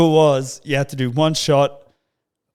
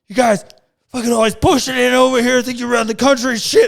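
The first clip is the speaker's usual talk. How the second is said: high, loud, rushing tirade; mock angry rant; 10 semitones higher, 6 dB louder than usual. Huge traveller berating an Australian at security, mock angry. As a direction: imitate an irate stranger laying into someone.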